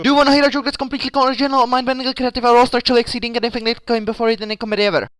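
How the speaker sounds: fast, high, loud hype; excited pitch. Pretend. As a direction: rush it out, overexcited salesman. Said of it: "plays out something like this".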